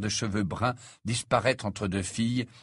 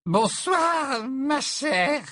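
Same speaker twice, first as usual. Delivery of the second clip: very high, effusive tipsy greeting; tipsy professor; 4 dB louder, much slower than usual. Acted. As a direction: greet grandly as a drunk old teacher. Tipsy Trelawney greets Luna.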